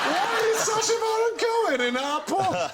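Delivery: very high mock shouting; hammed-up high-pitched disbelief; incredulous yell. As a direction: holler it like a disbelieving pub local. Bloke scoffs loudly in disbelief.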